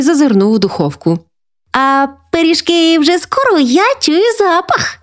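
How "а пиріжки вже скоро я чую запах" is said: put-on very high little-boy excitement; drawn-out, high, thrilled child voice; delighted exclamation. A narrator's reading. Little grandson smelling pies in the oven, thrilled. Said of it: "захоплено вигукнув він".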